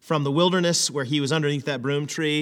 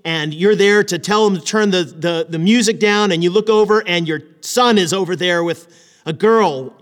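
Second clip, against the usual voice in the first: ordinary talk, nothing marked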